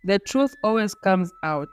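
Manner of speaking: ordinary delivery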